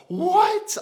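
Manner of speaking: loud, high-pitched imitation of a shocked partner; shocked outcry. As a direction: shriek it in mock disbelief. Partner's shocked reply to calling 911.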